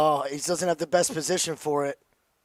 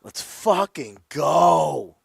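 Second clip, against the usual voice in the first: slow, savoured victory cry; drawn-out cheer; much slower than usual. Real, not acted. Bettor savouring his winning pick, drawn-out cheer. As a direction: stretch each word out in triumph.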